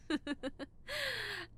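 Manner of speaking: bright teasing giggle; high giggle. Acted. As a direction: giggle at the nervous patient. Teasing scared patient.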